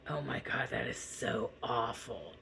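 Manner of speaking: inner thought. Retold or imitated. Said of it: "I thought".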